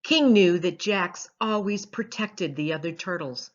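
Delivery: ordinary delivery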